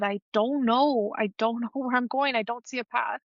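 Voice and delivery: real, mildly choked-up confession; getting emotional. Real, not acted. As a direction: admit the struggle, emotion creeping into the voice. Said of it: "I'm sorry if I'm getting emotional".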